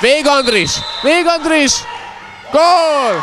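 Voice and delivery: goal scream, high and loud; excited hungarian sports commentary. Acted. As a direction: build to a roaring goal call. Goal call in live commentary.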